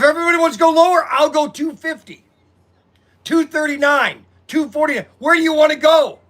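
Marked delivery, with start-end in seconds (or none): 5.2-6.2 challenging call out. Real, not acted. Challenging viewers on price.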